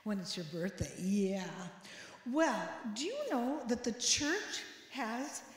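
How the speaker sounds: normal talking voice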